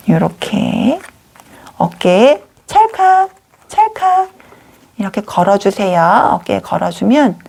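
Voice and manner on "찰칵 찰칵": crisp, much higher spoken "click"; high-pitched click sound effect. Pretend. Teacher fastens the doll's trousers, high playful click. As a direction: voice a little snap-on click, pitched way up. Imitating click while hanging shirt.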